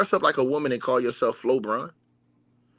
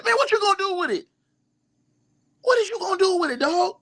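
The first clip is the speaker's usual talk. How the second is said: squeaky mocking disbelief, way up high; incredulous high-pitched mock. Mocking the fans who want the doll, squeaky incredulous voice. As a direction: throw it back at them in a high incredulous voice.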